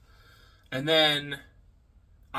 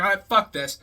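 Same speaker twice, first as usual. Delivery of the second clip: louder, higher fed-up snap; mock angry outburst; 5 semitones higher, 8 dB louder than usual. Brock fed up with Cody, loud mock anger. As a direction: blow up like you've had enough. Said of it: "He's like".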